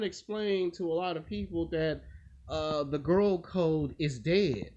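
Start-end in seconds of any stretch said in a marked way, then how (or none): none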